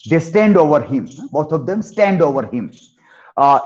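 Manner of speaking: ordinary talk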